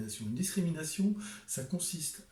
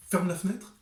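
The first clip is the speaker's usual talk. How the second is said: «Ferme la fenêtre» Parisian style, final e of «Ferme» dropped; Parisian accent; 6 semitones higher, 6 dB louder than usual. Shown as a demonstration. Author showing viewers the Parisian version, clipped. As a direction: clip «Ferme» short, Parisian. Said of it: "dans le premier cas".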